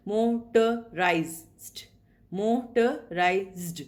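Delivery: "motorized" stretched very slowly, each syllable split apart. Shown as a demonstration. Teacher to students counting syllables, very slow split syllables. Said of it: "So there are four sounds".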